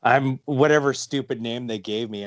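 a hammed-up, badly faked French accent; fake French accent. Mocking his own bad French accent for disguise. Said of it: "you're trying to put a French accent on it".

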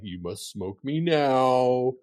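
slow, drawn-out tempting voice; weed talking. Retold or imitated. Weed stash calling to the parent, slow tempting voice. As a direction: lure him like a spellbound voice. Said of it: "it seriously speaks to me".

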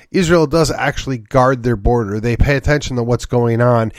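ordinary delivery

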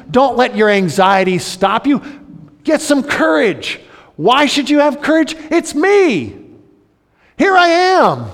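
high, loud preaching of Jesus' encouragement; rousing preaching. Acted. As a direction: lift them up at full force. Preacher voicing Jesus, rising.

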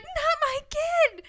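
very high, drawn-out comic wail; mock desperate plea. Mother jumps in front of her child, mock desperate wail. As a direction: stretch it out as a hammed-up protest.

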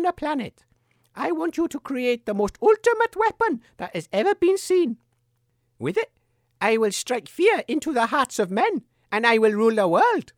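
evil queen. Acted. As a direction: demand power like a storybook tyrant. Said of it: "burst in ... and said".

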